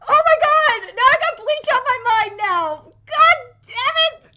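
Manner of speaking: loud, high fangirl wail; exasperated outburst. Real, not acted. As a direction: cry out in overexcited frustration. Mock exasperated fan outburst.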